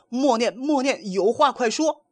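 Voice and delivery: high, pained cries of stop; crying out in pain. A narrator's reading. Wukong kneels in pain begging his master, high pained cry. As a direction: plead through pain, pitched high. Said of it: "跪在路边直叫".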